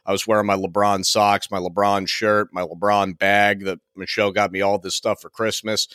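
ordinary delivery

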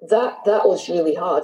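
choked up with real emotion; holding back tears on a hard memory; a touch of tearfulness, voice changed; emotional. Real, not acted. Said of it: "I don't know why I'm getting emotional".

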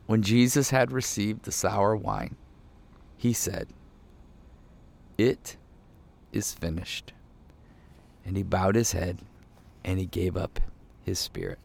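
hushed-down, measured verse with long pauses; solemn scripture reading. A narrator's reading. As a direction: read it gravely, phrase by phrase. Reads the Bible verse aloud.

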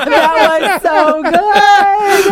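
high, slow, drawn-out laughing praise; helpless real laughter through the words. Real, not acted. Laughing at the accent joke.

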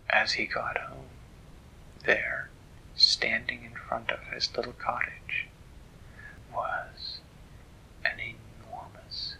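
soft, halting storyteller's hush; hushed suspense. A narrator's reading. Parent telling children what waited at the cottage, hushed suspense. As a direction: drop to a near-whisper, pausing to build suspense. Suspenseful build before the reveal.

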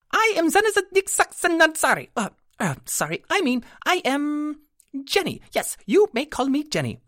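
very high fairy voice, stumbling over herself; a tiny creature correcting her own name; flustered pixie. A narrator's reading.

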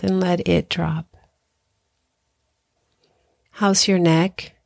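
unhurried meditation guide with long pauses; guided meditation. Acted. Hypnotherapist guiding listeners through body relaxation, calm guided meditation. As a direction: ease them through breathing and relaxing, leaving space after each phrase.

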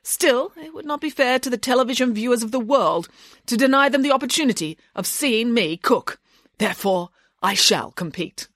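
high-pitched, self-important declaration; grandiose nanny, resolved. Acted. Nanny announcing she will compete, grandly resolved.

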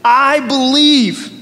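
loud, slow, high cry of "I believe". Acted. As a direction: cry it out like a desperate father. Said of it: "cried out and said".

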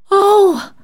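high, loud delighted oh; bright high cry of delight. A narrator's reading. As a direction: gasp with joy like a child. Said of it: "cried Polly with sparkling eyes".

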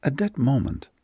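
usual speaking voice

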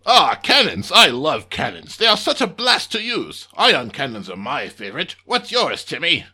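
loud gleeful alien commander; excited alien boss. Acted. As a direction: shout with delight.

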